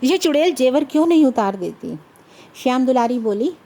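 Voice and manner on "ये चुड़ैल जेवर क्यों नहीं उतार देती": irritated, louder grumble; mildly acted annoyance; inwardly fuming. A narrator's reading. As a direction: fume at her in exasperation. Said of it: "दिल ही दिल में बिगड़ रहे थे".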